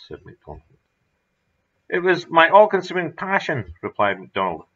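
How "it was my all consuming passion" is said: higher and louder, beaming with zeal; absurd smile. A narrator's reading. MacDonald revealing his secret cave, grinning with absurd pride. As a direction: gush it out like a proud obsessive. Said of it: "his face wreathed in an absurd smile".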